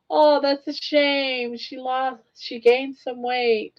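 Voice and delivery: put-on high, slow tut-tutting old lady; drawn-out fake sympathy, looking down on her; old members pitying a teen's weight gain; mock pitying old people. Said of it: "all these old people would".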